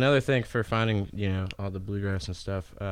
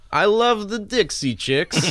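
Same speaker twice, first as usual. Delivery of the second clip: put-on a lightly done thick Russian accent, slow; dad's deadpan Russian-accented joke, drawn out; mock Russian accent, higher and louder; 11 semitones higher, 7 dB louder, slower than usual. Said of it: "in his thick Russian accent".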